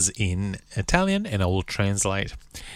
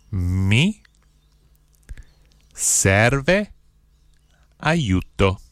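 each word set apart by long pauses; one word, pause, next word; slow, word by word; much slower than usual. Shown as a demonstration. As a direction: spell it out slowly for learners to repeat. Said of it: "then I'll say one word at a time slowly".

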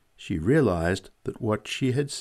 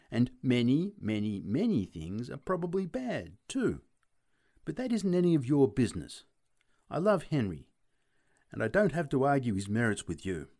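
higher-pitched, emphatic rebuke; indignant insistence; 5 semitones higher than usual. A narrator's reading. Woman refusing to argue about her fiancé, indignant. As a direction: put him firmly in his place. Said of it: "she cried".

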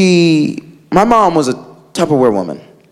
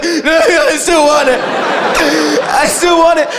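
put-on addicted kid; 7 semitones higher, slower than usual. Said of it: "He was like".

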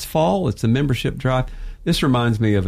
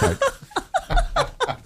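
words squeezed out through a high laugh; 4 dB quieter than usual. Real, not acted. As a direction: laugh at your own younger self while you talk. Laughing at his own naive self.

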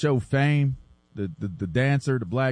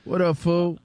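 mock slangy greeting, pitched up; Sticks greeting imitation; 6 semitones higher than usual. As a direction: imitate a buddy's higher-pitched hello. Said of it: "to Sticks like".

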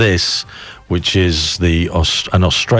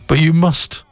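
much higher, urgent insistence; insistent priest; 11 semitones higher than usual. A narrator's reading. Priest urging the old man to give it up, insistent. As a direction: press the point with raised pitch. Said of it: "the priest insisted".

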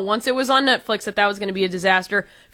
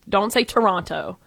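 usual speaking voice

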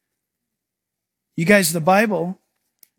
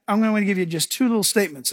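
ordinary talk

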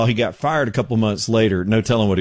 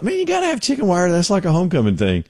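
excited exclaim; 8 semitones higher than usual. Retold or imitated. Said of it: "I was like".

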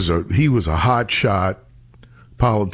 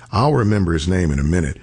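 ordinary delivery

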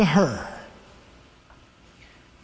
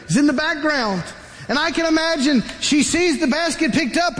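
usual speaking voice

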